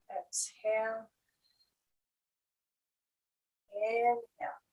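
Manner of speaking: calm, measured breathwork cues with long pauses; guided breathing. Acted. Teacher guiding students through inhale and exhale, guided breathing. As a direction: lead the breath in short, unhurried cues.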